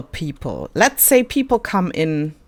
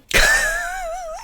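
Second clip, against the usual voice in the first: high-pitched little giggle; high laugh. Real, not acted. Player delighted by two same skins, high giggle. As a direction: squeal a short laugh.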